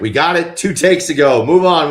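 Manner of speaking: loud, high "move on"; impatient director. Retold or imitated. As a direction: hurry them along, impatient. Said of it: "Kevin will be like".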